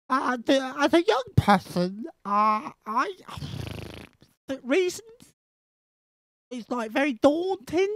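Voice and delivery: high lisping mimic of the guest; mocking lisp. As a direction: squeak it out with a mock lisp. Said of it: "it's suddenly just developed a lisp".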